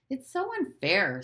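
aggrieved complaint. A narrator's reading. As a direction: complain like a hard-done-by little boy. Boy complains in voiced dialogue.

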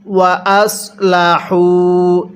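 ওয়া আসলাহু slowly with the heavy sad s; careful tajweed ওয়া আসলাহু; correct sad sound. Shown as a demonstration. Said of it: "আরেকবার পড়ুন".

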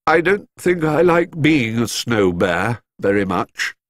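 ordinary delivery

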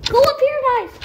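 high, loud call to teammates; urgent calling out. Real, not acted. Player calling guys up to hide, urgent.

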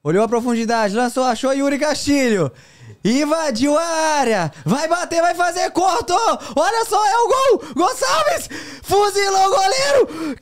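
goal call building to a screaming GOL; narração de gol explosiva. Pretend. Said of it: "depois vai ser sem narração pra você narrar". (narração de gol explosiva, gritando gol)

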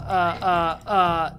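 usual speaking voice

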